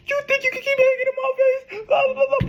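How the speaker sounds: very high, squeaky sobbing voice; mock crying. Imitating the crying ex on her third call, very high mocking whine. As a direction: whine it like a blubbering caller. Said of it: "she over here crying".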